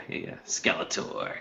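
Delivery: hushed unvoiced aside; whispering. Real, not acted. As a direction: breathe it out in a whisper. Whispered aside about Skeletor.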